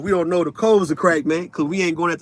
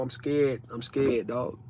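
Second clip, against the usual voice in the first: low, soft I'm scared; quiet confession; 5 semitones lower, 9 dB quieter, slower than usual. Acted. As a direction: let it sink to a murmur. Repeated confession fading low.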